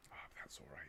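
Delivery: a private murmur to himself, barely voiced; whispered aside. Real, not acted. Host on a livestream mutters to himself in a whisper. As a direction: whisper it under your breath, voice off.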